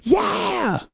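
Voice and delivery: high, strained whooping crowd; mock crowd cheer. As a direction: whoop like a rowdy audience. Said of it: "people in the background going".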